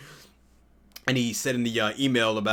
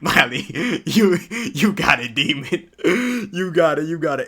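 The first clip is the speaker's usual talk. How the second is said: cheering on; 5 semitones higher, 7 dB louder, slower than usual. Retold or imitated. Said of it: "I'm like".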